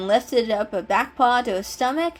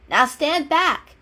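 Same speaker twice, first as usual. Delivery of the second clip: slow, loud, higher warning; warning call; 7 semitones higher, 6 dB louder, slower than usual. A narrator's reading. Cat warning his friend before the stunt, slow loud call. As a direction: call it out slowly, like a stunt about to happen.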